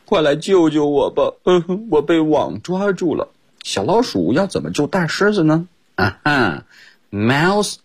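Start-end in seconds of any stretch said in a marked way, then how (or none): 0.0-3.3 high-pitched storybook plea; pleading lion. A narrator's reading. Teacher retells the netted lion's cry in Chinese, pleading and high. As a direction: beg for rescue as the lion, lightly acted. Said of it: "大狮子祈求道说".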